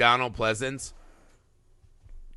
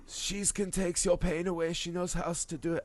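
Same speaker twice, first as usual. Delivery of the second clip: Werner Herzog impression; 2 semitones higher, 10 dB quieter than usual. Podcast host slips into Werner Herzog for his cohost, soft German accent. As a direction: whisper it like a brooding German filmmaker. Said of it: "I'm Werner Herzog again".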